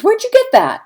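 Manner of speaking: high, loud acted-out query; incredulous questioning. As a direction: challenge a student in mock disbelief. Retells questioning a student's source.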